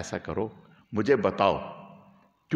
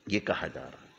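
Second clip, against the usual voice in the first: soft, lower, with a laugh; 6 semitones lower, 6 dB quieter than usual. Real, not acted. Preacher chuckles, repeating the offer to the companion.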